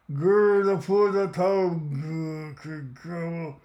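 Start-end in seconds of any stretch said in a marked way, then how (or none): 0.1-0.6 louder, sharp monster growl. A narrator's reading. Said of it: "the growl came again". 1.7-3.5 softer growl trailing off. A narrator's reading. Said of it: "the growl came again".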